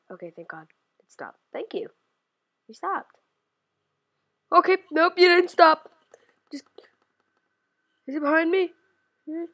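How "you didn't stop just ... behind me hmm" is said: ordinary talk, nothing marked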